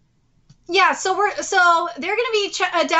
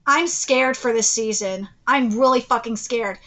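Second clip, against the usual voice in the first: usual speaking voice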